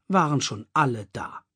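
ordinary delivery, nothing marked

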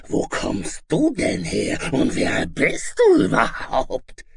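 high, loud, harsh monster demand; baffled, bullying dragon screech; affronted dragon. Acted. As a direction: screech the question slowly at the intruder. Baffled dragon challenges the boy.

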